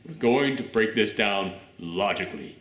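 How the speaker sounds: hint of a composed explainer, quietly insistent; calm British alter ego. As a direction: imitate him reasoning it out, low and measured. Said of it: "British version of him pops up and he goes".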